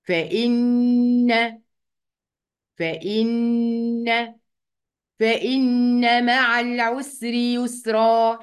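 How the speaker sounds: "فإن" with a very long humming doubled n; doubled noon ghunnah. Shown as a demonstration. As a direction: press on the n of "فإن" and hold the nasal hum. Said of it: "بتك على النون كده وبجيب غنة".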